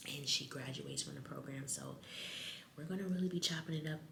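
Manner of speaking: hushed vlog whisper; low-volume whispered catch-up; library whisper. Real, not acted. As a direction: keep it down, chatting softly in a library.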